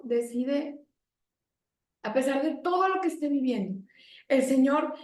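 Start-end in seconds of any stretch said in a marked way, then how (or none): none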